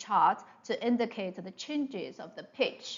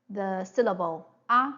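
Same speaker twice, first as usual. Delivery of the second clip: ordinary talk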